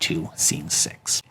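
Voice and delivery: breathy whispered aside. Real, not acted. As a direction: trail off into a whisper. Closing scene announcement, dropped.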